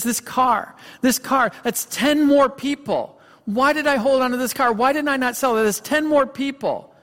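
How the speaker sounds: imitating a man breaking down, high-pitched; high, agonized retelling of a desperate man; distraught breakdown. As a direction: plead in anguished self-reproach, pitch well up. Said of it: "he breaks down and he's crying".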